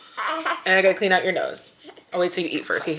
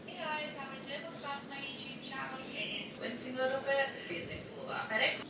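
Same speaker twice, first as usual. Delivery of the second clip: far off; 7 semitones higher, 18 dB quieter than usual. Real, not acted. Recorded intro voice.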